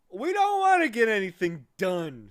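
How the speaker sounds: high, loud indignant snap; snapped angrily. Acted. Lethargarian snapping at Milo, loud and high. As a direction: bite back at Milo, offended. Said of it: "snapped another angrily".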